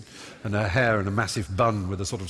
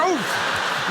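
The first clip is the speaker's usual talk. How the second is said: exasperated groan; 4 dB louder than usual. Retold or imitated. Mother's exasperated reaction.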